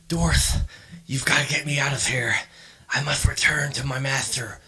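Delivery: hissing, lisping prisoner whisper; lispy whisper. Acted. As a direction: whisper it with a strange lisp. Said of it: "he whispers to you in this, like, strange, like, lispy type of voice".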